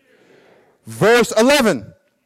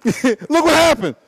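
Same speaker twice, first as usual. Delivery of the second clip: ordinary talk, nothing marked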